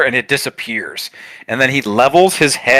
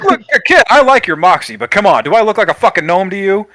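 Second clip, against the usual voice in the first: higher, forceful, affronted character voice; exasperated tough guy; 7 semitones higher, 3 dB louder than usual. Acted. Ringmaster to a kid who took him for a gnome, indignant. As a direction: set the kid straight, offended.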